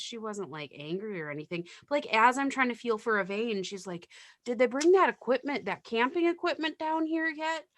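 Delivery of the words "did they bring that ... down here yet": lightly voiced confused little old lady, higher pitch; chatty, higher-pitched mock patient. Retold confused patient to nurse.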